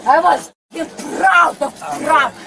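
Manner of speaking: slurred furious yell. Real, not acted. Drunk passenger shouting at crew.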